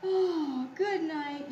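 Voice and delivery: storybook yawn through the words. A narrator's reading. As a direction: open with a big voiced yawn. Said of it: "yawned Possum".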